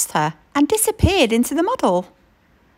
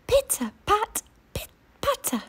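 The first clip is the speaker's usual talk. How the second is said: high, much softer little patter; tiny footsteps; 10 semitones higher, 7 dB quieter than usual. A narrator's reading. Tiny mammoth pattering, high and hushed. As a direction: tiptoe the words up high and soft.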